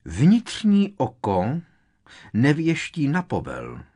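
high, loud, affronted declaration; indignant. A narrator's reading. Seer refusing to predict on command, indignantly. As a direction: proclaim it in offended outrage. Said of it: "prohlásila pohoršeně".

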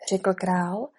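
ordinary delivery, nothing marked

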